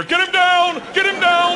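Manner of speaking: high, loud acted alarm; urgent shouted command. Sound guy in corner orders comic restrained, high urgent shouting.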